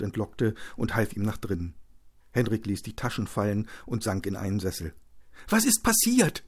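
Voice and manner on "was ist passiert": very high, urgent wife's voice; alarmed. Acted. Wife fetching bandages for her beaten husband, alarmed. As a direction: repeat the question in high alarm. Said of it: "wiederholte Josephine".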